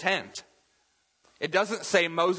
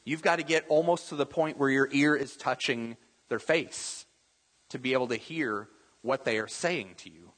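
usual speaking voice

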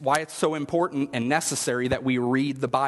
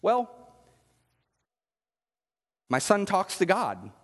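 mom's calm reply; 5 semitones higher than usual. Retold or imitated. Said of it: "And she says".

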